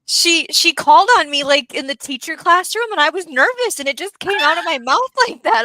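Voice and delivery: accidental Irish lilt. Real, not acted. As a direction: tell it while a nervous Irish lilt creeps in. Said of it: "Why am I talking like this?".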